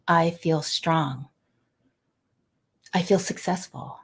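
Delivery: slow, soft self-affirmation; quiet heartfelt affirmation. Shown as a demonstration. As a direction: say it to yourself in the mirror, slowly. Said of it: "and say out loud".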